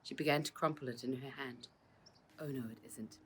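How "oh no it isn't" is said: soft, low murmur under the breath. Acted. Rose murmurs to Josephine, a soft low murmur. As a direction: murmur it almost to yourself. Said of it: "murmured Rose".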